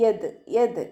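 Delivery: യത് with a bouncing, fully stopped final dal; യത് with a clear qalqala bounce on the dal; qalqala demo. Shown as a demonstration. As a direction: echo the dal of യത് with a full stop. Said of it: "ദാൽ ഖൽഖല letter ആയതുകൊണ്ട് full സുക്കൂന് കൊടുക്കുക".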